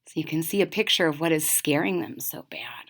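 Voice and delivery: quick explaining whisper. Real, not acted. Reader explaining the picture to children, whispering. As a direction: keep the hush while you explain. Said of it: "aside to listeners, still whispering".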